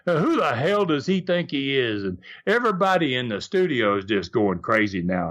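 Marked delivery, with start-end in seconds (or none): none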